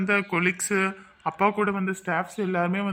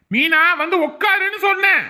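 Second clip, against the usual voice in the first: much higher, loud scolding shout; angry shouted order; 11 semitones higher, 6 dB louder than usual. Acted. Man ordering a quarrelling woman to sit, angry shout. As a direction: shout the command to end it.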